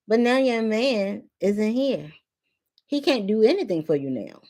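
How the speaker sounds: normal talking voice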